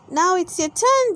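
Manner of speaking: lightly voiced little pig girl; Peppa voice. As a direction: Peppa asking Daddy, in a high girl voice.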